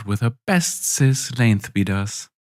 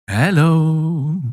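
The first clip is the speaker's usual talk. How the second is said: bright sing-song greeting, higher and louder; 8 semitones higher, 4 dB louder than usual. Acted. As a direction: call out hello in a lilting tune. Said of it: "calls out to them in a sing-song voice".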